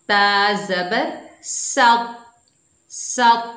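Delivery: सत with a thick, heavy emphatic t, mouth full and rounded; teacher shows the heavy सत, drawn out slowly. Shown as a demonstration. Teacher shows pupils the thick sound, slow and full. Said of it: "देखिए आवाज़ मेरी मोटी आ रही है".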